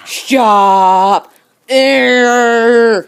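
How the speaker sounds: loud firm command; loud stop. Real, not acted. Dog biting, pushing back.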